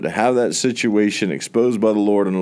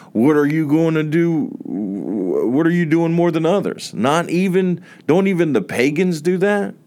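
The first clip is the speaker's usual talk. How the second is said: raised, ringing scripture reading; preaching scripture; 5 semitones higher than usual. Acted. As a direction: proclaim the verses to the congregation.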